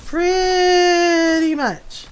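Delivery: high, very slow wail; dramatic agreement. Real, not acted. Agreeing that Ron is furious, mock dread. Joking about husband's anger.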